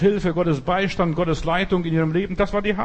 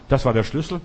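ordinary talk

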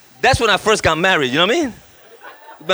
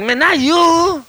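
high, drawn-out mock whine; retold wounded complaint; dismayed protest; 9 semitones higher, slower than usual. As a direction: whine it in disappointment. Re-enacting dismayed reply to God.

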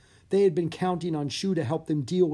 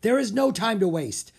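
urgent; 8 semitones higher, 5 dB louder than usual. Acted. Griffith urging haste.

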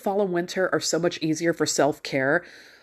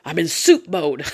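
George Costanza; 4 dB louder than usual. Retold or imitated. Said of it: "that George from Seinfeld".

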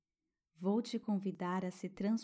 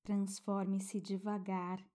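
guided meditation. Acted. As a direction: soothe a child toward sleep.

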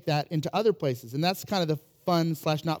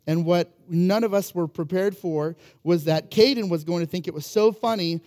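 normal talking voice

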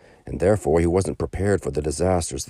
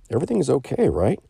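friar's question; 10 semitones higher, 4 dB louder, faster than usual. Acted. Friar checks on merchant.

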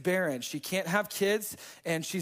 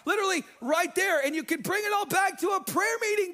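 rising preacher shout; 9 semitones higher than usual. Real, not acted. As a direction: lift it into a triumphant shout. Preacher driving home the point.

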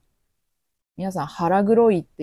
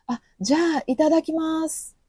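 bright eager acceptance, pitched much higher; 11 semitones higher than usual. Retold or imitated. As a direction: accept the offer cheerfully, naive guest. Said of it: "と言ってもいいですか". (上機嫌に高い声で受け入れる)

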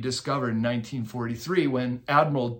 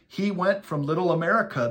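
usual speaking voice

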